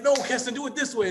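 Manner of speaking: high, urgent correction of a son; passionate coaching. Retold or imitated. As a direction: call out to the kid on the field to fix it. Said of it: "And my passion".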